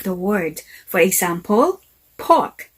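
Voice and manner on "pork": PORK with the R barely pronounced; British silent R. Shown as a demonstration. Teacher gives an example of British accent, soft-R "pork". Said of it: "R is pronounced very softly".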